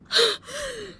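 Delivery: sharp, very high gasp of shock; a sudden intake of breath at something awful; real shocked gasp. Real, not acted. Shocked by the harsh thought.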